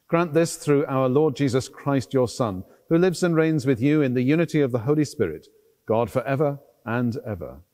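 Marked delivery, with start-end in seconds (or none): none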